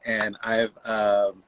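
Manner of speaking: usual speaking voice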